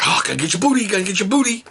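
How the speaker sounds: high sing-song booty chant; playful sing-song. Pretend. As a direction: tease her like a silly game.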